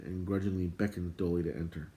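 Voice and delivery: ordinary delivery, nothing marked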